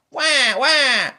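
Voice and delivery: piercing, loud fake baby wail; hammed-up high baby crying. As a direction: bawl a put-on "wah" like an infant. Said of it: "I fake cry on purpose".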